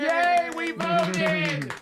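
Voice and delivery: high, slow hammed-up cheer; mock cheer. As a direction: stretch out a fake happy yay. Mocking North Korean voters cheering.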